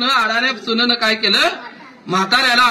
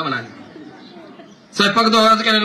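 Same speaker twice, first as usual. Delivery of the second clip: ordinary delivery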